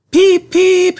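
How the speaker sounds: high, loud train whistle peep; whistling. A narrator's reading. Edward whistles happily as he puffs away, high and loud. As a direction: toot it out like a little engine's whistle. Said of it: "he whistled".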